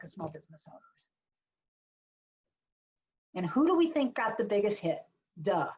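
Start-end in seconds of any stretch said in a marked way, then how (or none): none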